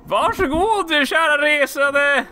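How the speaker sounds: high, slow, sing-song merchant greeting; friendly shopkeeper voice. Acted. Gamer voicing the shopkeeper greeting a traveller, high and slow.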